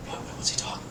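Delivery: hushed, rapid whisper; barely audible gossiping murmur; crowd whispers. Acted. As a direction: whisper it like a puzzled onlooker. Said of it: "you hear, like, whispers".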